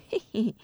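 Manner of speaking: soft, sly "heh heh"; sinister chuckle. A narrator's reading. Villain answers the son's angry challenge, sinister chuckle. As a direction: chuckle quietly with villainous glee. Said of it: "嘿嘿奸笑".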